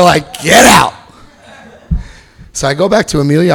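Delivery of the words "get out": louder, abrupt mock dismissal; retold stern order. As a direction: throw them out, acting it. Staff throw him out.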